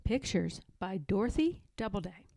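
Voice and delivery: ordinary delivery, nothing marked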